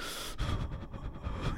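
imitated heavy breathing, no voice. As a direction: breathe out heavily, mimicking a noisy breather. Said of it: "heavy breathing a little while ago".

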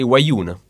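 "vaiuno" as wa-YOO-n, Neapolitan style; Neapolitan dialect word. Shown as a demonstration. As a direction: say "vaiuno" with a w start and swallowed last vowel. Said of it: "in Naples, they say vaiuno".

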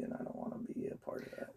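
a hushed, breathy aside to yourself; whispered. Retold or imitated. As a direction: drop to a near-silent whisper. Fearful thought retold, whispered.

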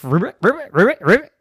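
a chorus of frogs answering; frogs croak back. Acted. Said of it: "the frogs ribbited back".

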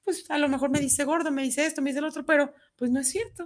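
lightly voiced child dismissing the bullies; child shrugging off. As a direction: play a kid brushing off insults. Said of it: "y que ellos digan".

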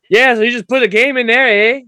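put-on Canadian accent. Buddy helping install a PS two, mock Canadian accent. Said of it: "a buddy of mine that's from Canada".

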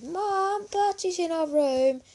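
high, loud, tattling wail; calling out for Mum. A narrator's reading. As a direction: shout for Mum to come. Girl woken up calls her mum.

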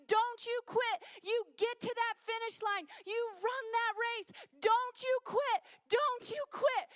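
loud, high coach's shout from the finish line; coach yelling. Retold or imitated. Coach shouting at a tired runner to keep going, booming. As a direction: bellow it like a coach urging a runner on. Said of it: "he would start yelling at me".

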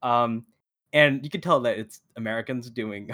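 ordinary talk, nothing marked

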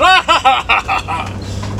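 real burst of laughter at the friend's joke; laughing. Real, not acted. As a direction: laugh out loud at the memory.

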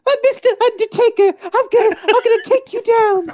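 very high wrestling trash talk; goofy high-pitched impression of a challenger; squeaky mock threat; Lin-Manuel. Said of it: "he was like".

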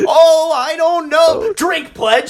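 high, slow, wailing mock panic; panicked pledge. Pledge flailing at the frat test question, high wail. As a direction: whine it out, cornered and clueless.

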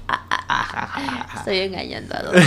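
put-on fast sneaky villain child; scheming whisper-laugh kid; evil kid voice. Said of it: "los niños malvados".

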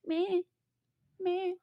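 put-on high, thin baby fussing sound; baby whimper. Imitating the baby to the co-host, high fussy bleats. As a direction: mimic a fussy baby's little cries. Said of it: "She'd be like".

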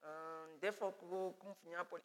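lightly voiced conspiring Lucifer; a hint of a sly plotter, in Nyanja; mild scheming Lucifer voice. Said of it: "Lucifer ... told them".

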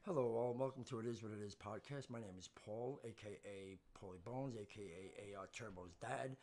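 low, soft announcer intro; podcast host intro. Acted.

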